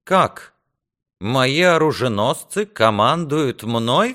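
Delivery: loud, high, slow outburst; indignant cry. A narrator's reading. Lord defied by his own squires, indignant cry. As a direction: cry out in affronted disbelief. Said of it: "вскричал Реморни".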